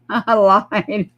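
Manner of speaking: real laugh while admitting the lie; laughing confession. Real, not acted. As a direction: laugh as you own up to the fib.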